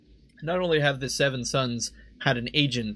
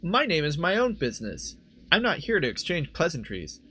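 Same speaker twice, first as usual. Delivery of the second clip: Imoen's raised-pitch character voice; higher voice, in character; 5 semitones higher than usual. Acted. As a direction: pitch it up for a curt heroine. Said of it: "we're gonna use a higher pitched voice".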